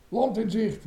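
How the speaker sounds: high-pitched lookout's cry; excited call. A narrator's reading. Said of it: "riep hij".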